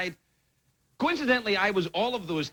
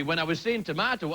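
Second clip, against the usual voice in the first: ordinary talk, nothing marked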